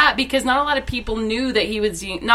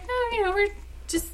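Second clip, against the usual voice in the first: much higher and softer, casual wave-off; airy imitation of Summer; 10 semitones higher, 5 dB quieter than usual. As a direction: gently shoo the manager away. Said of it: "she's like".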